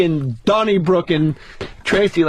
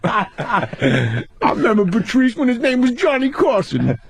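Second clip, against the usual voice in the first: Tracy voice; 4 semitones higher, slower than usual. Retold or imitated. Continuing Tracy voice.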